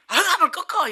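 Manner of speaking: rowdy shout. Retold or imitated. Pastor imitates a friend yelling to drink it off. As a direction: bellow it like a drinking buddy. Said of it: "이런단 말이죠".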